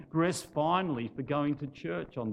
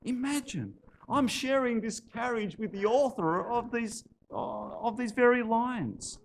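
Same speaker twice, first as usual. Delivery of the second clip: lightly voiced delighted woman, pitched high; high, mildly excited wonder; exclaiming; 7 semitones higher than usual. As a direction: marvel at it in a higher voice. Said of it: "she exclaimed".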